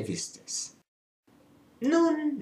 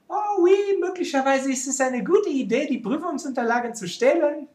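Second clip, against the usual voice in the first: high-pitched, louder voice of someone having a bright idea; mock enthusiasm, pitched well up, French accent; imagined naive schemer; 8 semitones higher, 4 dB louder than usual. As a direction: act out a foolish thought in a raised voice. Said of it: "die sich schon einmal überlegt hatten".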